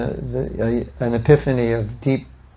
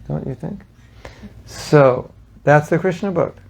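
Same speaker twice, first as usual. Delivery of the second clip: usual speaking voice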